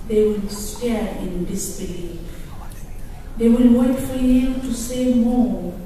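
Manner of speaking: rising, declaiming poem reading; poem recital. Acted. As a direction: read it like a defiant anthem to an audience. Reads her translated poem aloud.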